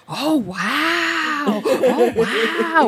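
high, wide-eyed oh wow; amazed toddler. Retold or imitated. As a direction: gasp it like a wowed little kid. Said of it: "he's very good at being like".

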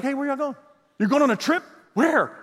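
high, quick nosy questions to departing friends; pry like you can't bear missing out; eager nosy calling. Retold or imitated. Pastor imitating himself quizzing friends who are going out, high and nosy. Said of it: "I'm like".

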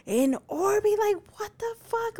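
high-pitched disbelieving question; retold freak-out. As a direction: replay your shocked reaction, voice shooting up. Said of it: "freaking out".

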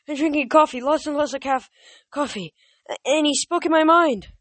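high, loud jittery babble; frantic satyr. Acted. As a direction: babble like a panicked goat-man. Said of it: "he stammered".

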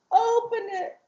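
put-on high, small child's command; little girl voice. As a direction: say it like a tiny girl giving an instruction. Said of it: "she's talking like this".

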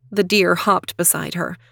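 ordinary delivery

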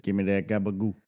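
low, soft mock New York Italian; New York Italian accent. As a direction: mutter it in mock Italian-American. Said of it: "Italian accents are from New York".

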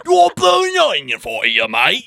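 hammed-up Australian accent, pitched high and loud; cartoonish Australian bloke voice, high and loud; mock Australian accent. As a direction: play a rowdy Aussie waiter in an over-the-top Australian accent. Aussie waiter bit again.

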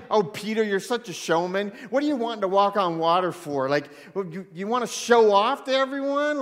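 loud, exasperated put-down, acted out; imitating a disapproving rebuke; mock scolding. Imagined Jesus chiding Peter for showing off, mock scolding.

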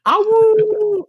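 high yipping wolf howl; howling like a wolf pup. Acted. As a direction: throw back your head and howl. Howls after song joke.

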